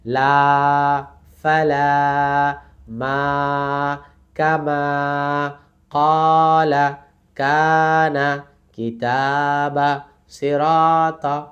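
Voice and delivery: each word slow on a level held note; stretched recitation drill. Shown as a demonstration. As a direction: sustain the long vowels in লা, ফালা, মা. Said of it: "বলেন আমার সাথে".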